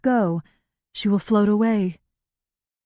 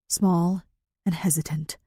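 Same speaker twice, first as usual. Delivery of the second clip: usual speaking voice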